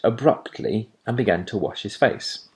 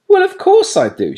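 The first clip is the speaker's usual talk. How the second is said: very high, emphatic exclamation; scornful surprise; 8 dB louder than usual. A narrator's reading. Girl amazed Plop asks, very high and emphatic. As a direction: scoff at the silly question.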